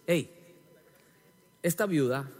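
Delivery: ordinary talk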